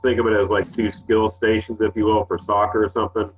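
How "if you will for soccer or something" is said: ordinary talk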